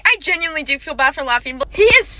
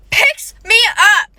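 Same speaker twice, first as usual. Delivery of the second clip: excited shout; 7 semitones higher, slower than usual. Real, not acted. Building to climax.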